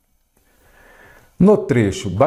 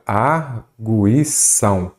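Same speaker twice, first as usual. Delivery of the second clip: "Arguição" very slow, broken into its syllables; "Arguição" spelled out slowly in separate beats; much slower than usual. Shown as a demonstration. Same word split into syllables.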